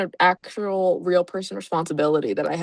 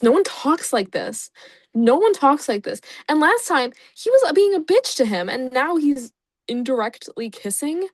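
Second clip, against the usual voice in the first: ordinary talk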